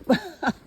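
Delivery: sudden laugh at a trip; startled laugh. Real, not acted. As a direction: laugh as you stumble. Stumbles on the trail.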